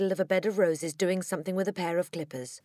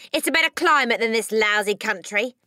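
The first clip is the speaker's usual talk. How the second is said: loud, higher rough-dad grumble; gruff, dismissive father; 4 semitones higher, 6 dB louder than usual. Acted. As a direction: brush the child off loudly.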